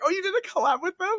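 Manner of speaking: very high, gushing question; excited fan. Retold or imitated. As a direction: ask it like a thrilled fan. Fans excitedly asking about collab.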